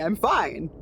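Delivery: usual speaking voice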